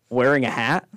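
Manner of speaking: ordinary talk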